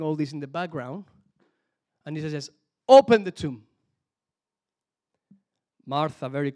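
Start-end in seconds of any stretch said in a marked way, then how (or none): 2.9-3.6 loud, firm order; authoritative burst on "Open"; commanding. Acted. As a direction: call it out as a command. Acting Jesus' command at tomb.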